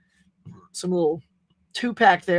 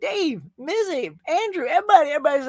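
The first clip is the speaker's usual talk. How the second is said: excited hype; 8 semitones higher, 5 dB louder than usual. Real, not acted. Excited hype after song.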